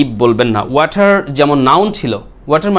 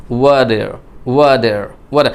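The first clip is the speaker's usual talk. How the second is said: American accent; 4 semitones lower, much slower than usual. Shown as a demonstration. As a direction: say "water" the American way, like "wa-der". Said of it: "according to the American accent". (American উচ্চারণে "water", ট হয়ে যায় ড)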